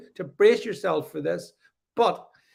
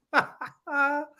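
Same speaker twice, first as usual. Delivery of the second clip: burst of laughter; 9 semitones higher than usual. Real, not acted. Laughing at the story.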